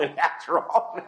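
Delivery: chuckling through a retold fault-finding; amused imitation of a nitpicking officer; laughing mock officer. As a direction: laugh while listing the wrong things. Officer listing faults, preacher laughs.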